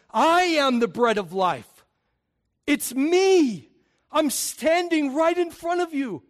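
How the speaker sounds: high, ringing proclamation; preaching Jesus' words. Acted. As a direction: declare it as if standing before them.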